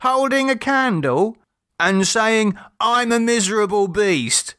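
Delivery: put-on high child's voice with a laugh; a child enjoying the bargain; mocking child. A narrator's reading. Children add demands.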